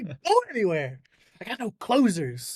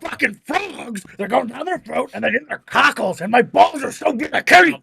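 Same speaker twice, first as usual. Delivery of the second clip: high, ranting voice, getting louder; hammed-up conspiracy host; Alex Jones; 5 semitones higher, 7 dB louder than usual. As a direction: build a strained, absurd rant. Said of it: "Alex Jones goes nowhere".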